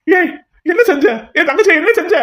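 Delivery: shouted high and loud in horror; outraged shout; horrified shout. Acted. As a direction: scream it at him, appalled. Shocked at sister sold.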